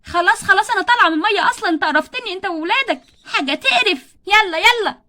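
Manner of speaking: loud, disgusted; wife leaves pool shouting at husband; angry exit. Acted. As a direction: storm out of the water. Wife quits angrily.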